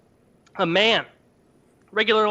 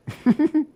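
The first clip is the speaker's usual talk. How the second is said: short high laugh; 10 semitones higher than usual. Real, not acted. Laughs at own [name] bit.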